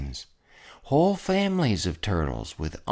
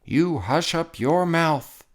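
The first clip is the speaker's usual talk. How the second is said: howled; 7 semitones higher than usual. A narrator's reading. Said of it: "howled the mighty King Yertle".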